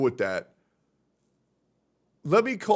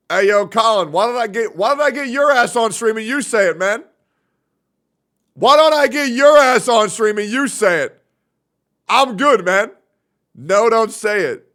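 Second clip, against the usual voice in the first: raised, high-pitched challenge; loud call-out; 5 semitones higher, 7 dB louder than usual. Real, not acted. Streamer challenging someone to come on stream, loud call-out. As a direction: call him out loudly, voice pitched up.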